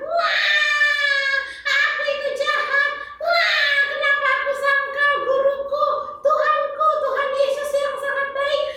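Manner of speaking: puppet girl overacting Peter's tears; hammed-up wailing remorse. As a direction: pretend crying lament. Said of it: "kamu menjiwai sekali".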